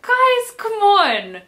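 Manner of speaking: mock-scolding groan; exasperated. Real, not acted. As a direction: plead with them to knock it off. Exasperated at the characters.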